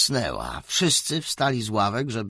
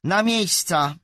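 louder, harder repeat of the order; firmer, louder teacher command; 9 semitones higher, 7 dB louder than usual. Acted. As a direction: bark the order louder this time. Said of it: "bardziej stanowczym tonem".

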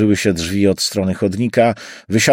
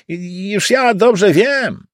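high, slow, drawn-out cabbie voice; a know-it-all cabbie; cab driver; 12 semitones higher, 3 dB louder, much slower than usual. Acted. Driver insisting to his passenger, high drawn-out cabbie voice.

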